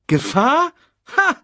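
high, loud scoff; boastful. A narrator's reading. Simba brushes off Sasu's warning, boastful. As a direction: scoff at the very idea with bravado. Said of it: "prahlte Simba".

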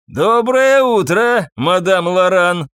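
loud, drawn-out mocking greeting; high, stretched-out call. A narrator's reading. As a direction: holler it slowly up to a window. Thug calls up from outside.

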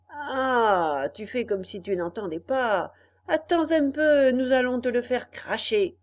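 loud menacing robber; angry threat. Acted. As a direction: threaten him loudly. Robbers threaten to force him.

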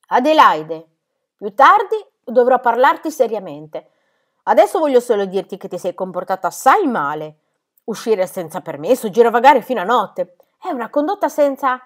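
loud, measured, menacing scolding; controlled anger with a threat in it; calm but threatening. A narrator's reading. Governess scolding Heidi for going out, calm but threatening. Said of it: "con calma ma in tono minaccioso disse".

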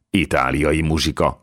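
usual speaking voice

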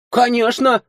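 put-on high, offended girl; offended snort. A narrator's reading. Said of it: "обиженно фыркнула девушка".

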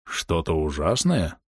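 slow, stunned question, dropped low; stunned echo. A narrator's reading. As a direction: repeat it slowly in disbelief. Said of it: "эхом повторил поражённый Таутон". (поражённо, медленным эхом)

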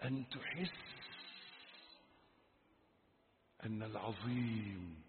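soft, low, reverent phrases; name Him quietly, with pauses; hushed awe. Real, not acted. Hushed awe before God. (خشوع بصوت منخفض)

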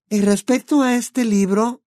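put-on high, bright young witch voice; Hermione's voice. A narrator's reading. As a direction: voice the clever girl friend.

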